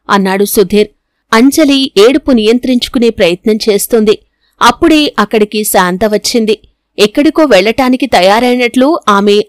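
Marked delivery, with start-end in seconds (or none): none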